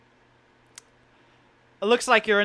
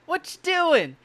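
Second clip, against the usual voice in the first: put-on gruff old miner; 9 semitones higher than usual. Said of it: "Pa looks at you and is like".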